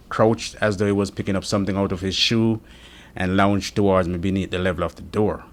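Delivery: ordinary delivery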